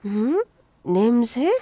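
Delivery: usual speaking voice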